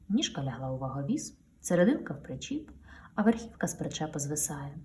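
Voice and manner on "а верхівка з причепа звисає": usual speaking voice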